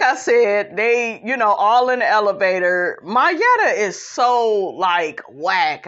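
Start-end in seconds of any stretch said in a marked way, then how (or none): none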